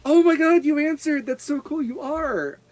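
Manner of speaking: put-on high, excited childlike gush; thrilled little girl. Sea elf child thrilled Acacia is a druid, high and gushing. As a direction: gush like a kid who found a friend.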